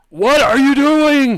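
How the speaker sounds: high, drawn-out yell; loud shouted challenge as a thug; thug's angry yell. Acted. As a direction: bellow it in outrage, stretching the words. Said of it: "you see one of the ex-, the thugs".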